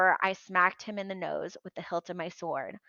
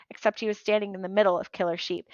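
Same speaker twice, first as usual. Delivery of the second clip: ordinary delivery, nothing marked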